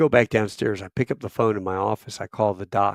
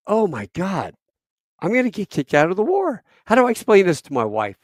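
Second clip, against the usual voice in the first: high, loud retold panic; hammed-up alarm, getting quicker; panicked inner voice; 7 semitones higher, 5 dB louder than usual. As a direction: spiral into mock dread, pitch jumping up. Acting out his panicked inner thoughts.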